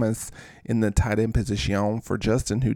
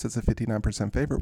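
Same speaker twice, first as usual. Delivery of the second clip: normal talking voice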